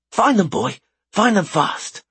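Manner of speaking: high, eager command to a dog; urging a pet. Acted. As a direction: send the pet off urgently. Commanding the wyvern.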